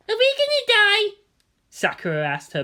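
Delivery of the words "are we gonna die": put-on scared little girl. Little sister asks brother.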